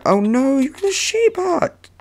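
very high, drawn-out wail; alarmed squeal. Real, not acted. Player spots an intruder in the sheep hut, alarmed squeal. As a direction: squeal in rising panic.

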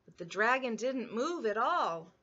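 ordinary delivery, nothing marked

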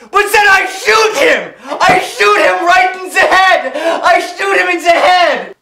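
put-on high, loud German-accented boast; excited hammy German shouting; gleeful shouting. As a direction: shout the triumph with manic glee. Pilot boasts of the killing.

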